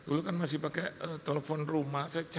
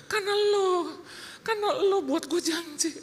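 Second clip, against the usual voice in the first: very high mock-wailed blame; protesting retort. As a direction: throw the blame back, pitched way up. Retold self blames the collector. (bantahan melengking menyalahkan)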